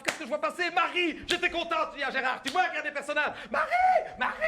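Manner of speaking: high, loud thrilled shout; excited shouting. Retold or imitated. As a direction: shout like a starstruck fan. Excitedly spotting Marie.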